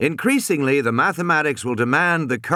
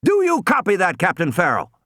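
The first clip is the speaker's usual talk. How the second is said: high, insistent radio demand; sharp official challenge, starting high; 7 semitones higher than usual. A narrator's reading. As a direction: press the captain hard for an answer. Demands answer after silence.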